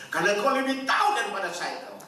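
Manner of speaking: high, loud, fast fervent praise; fervent prayer. Acted. Preacher leads thanks to God, fervent loud praise. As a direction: cry it out to God.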